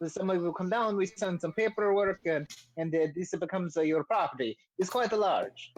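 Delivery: eager salesman with a hammed-up Italian accent; Volo, bad Italian accent. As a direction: talk up the property in a comic Italian accent. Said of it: "the bad Italian accent I was doing for Volo".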